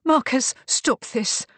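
high, distressed appeal; gasped. A narrator's reading. Beatrice begs Marcus to stop, gasping. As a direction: plead as if gasping, voice lifted. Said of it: "gasped Beatrice".

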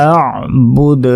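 আ'বুদ ending in an echoing dal; আ'বুদ with qalqalah. Shown as a demonstration. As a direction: bounce the final dal in আ'বুদ. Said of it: "কলকলা কিন্তু অবশ্যই করতে হবে".

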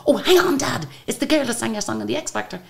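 lightly acted, high-pitched recognition; son stopping his dad. [name]'s son tells his dad who she is, high-pitched excitement.

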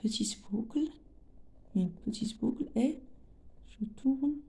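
whispered while tracing; soft unvoiced murmur as she draws. Real, not acted. Whispering while drawing the loop. (chuchoté en traçant)

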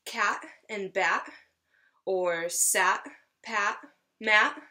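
put-on each rhyming word drawn out slowly for children; teacher slowing down to show the rhymes; slow rhyme examples. Shown as a demonstration. Teacher showing young listeners rhyming words, slow and clear. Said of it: "a rhyme is a word".

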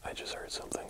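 tense hushed whisper. Real, not acted. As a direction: whisper so nobody hears you. Hears noise in dark basement.

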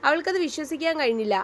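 ordinary delivery, nothing marked

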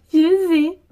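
high, loud sobbed reply; acted crying wail; tearful admission. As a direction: admit it through put-on tears. [name] admits fault in tears.

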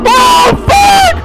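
panicked high shouted swearing; swearing tirade. Real, not acted. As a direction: scream curses while fleeing. Chased by game monster.

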